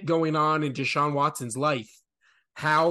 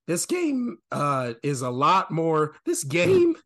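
voice cracking. Real, not acted. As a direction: voice cracks up into a squeak. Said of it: "Blowing people's fucking eardrums out with my fucking squeaking".

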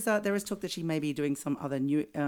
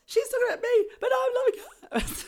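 giddy inner squeal; 6 dB louder than usual. Retold or imitated. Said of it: "I'm like".

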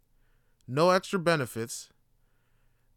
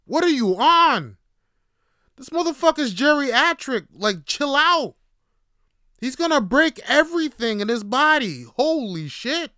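shouting at the coworker to chill out; loud outraged plea; 11 semitones higher, 10 dB louder than usual. Retold or imitated. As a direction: yell at him to back off. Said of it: "I'm like".

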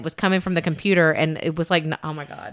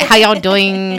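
bad Southern impression; 6 dB louder, slower than usual. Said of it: "I can't do it".

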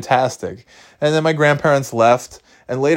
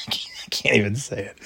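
whispered through real laughter; can barely get it out for laughing; 7 dB quieter than usual. Real, not acted. Laughing too hard to finish.

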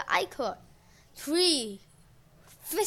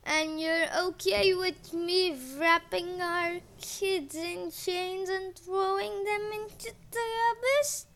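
slow, higher put-on voice; slow god voice; 5 semitones higher, slower than usual. A narrator's reading. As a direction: ask it slowly and carefully. Uranus voiced in story.

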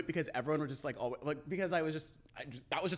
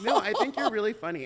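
laughing; 6 semitones higher, 7 dB louder than usual. Real, not acted. Laughing through praise.

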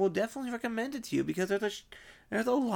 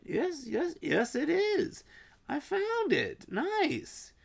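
high-pitched triumphant relief; excited, lifted voice; delighted discovery; 7 semitones higher, slower than usual. Real, not acted. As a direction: celebrate finally finding it. Finally finds the show.

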